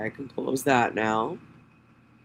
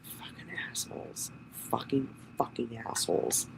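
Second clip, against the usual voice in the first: hushed, barely voiced swearing; whispered cursing; 12 dB quieter than usual. Real, not acted. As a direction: whisper under your breath, to yourself. Muttering to self over a document.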